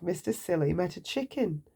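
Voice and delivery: ordinary delivery, nothing marked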